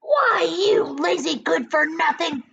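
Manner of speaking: high, loud scolding hag; angry aunt's cry; Aunt Spiker scolding. A narrator's reading. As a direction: berate the lazy boy. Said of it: "cried Aunt Spiker".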